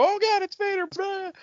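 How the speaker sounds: ordinary talk, nothing marked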